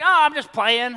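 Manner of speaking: high, loud fake-casual brush-off; fake breezy. Father brushing off his kid, high fake casual. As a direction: cover the anger with a loud breezy laugh-off. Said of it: "I was like".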